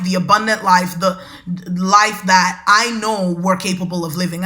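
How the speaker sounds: ordinary talk, nothing marked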